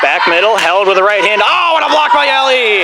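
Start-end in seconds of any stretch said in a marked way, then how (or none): 1.4-2.8 thrilled commentator calling out; excited play call. Real, not acted. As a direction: burst out at a huge block. Commentator exclaims at big block.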